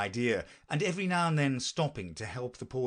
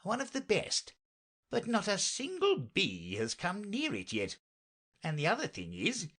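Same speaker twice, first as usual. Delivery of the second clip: higher, swooping, disgruntled knight; discontented knight; 4 semitones higher than usual. Acted. Knight explains his useless beehive to Alice, discontented and lilting. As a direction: puzzle it out with rising and falling pitch.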